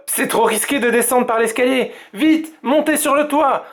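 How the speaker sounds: papa paniqué, voix haute; papa affolé. A narrator's reading. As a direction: pousser vite les enfants au toit. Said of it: "s'écrie papa affolé". (papa affolé, voix haute)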